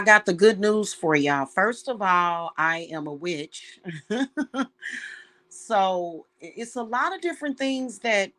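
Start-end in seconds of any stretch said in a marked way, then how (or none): 3.8-5.1 high, airy burst of laughter. Real, not acted. A witch laughing at her own reveal, a real laugh.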